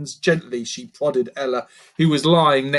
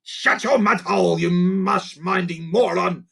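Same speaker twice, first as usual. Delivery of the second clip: grunting insult; 6 semitones higher than usual. Acted. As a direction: snarl at a dim mate. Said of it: "grunted Bart".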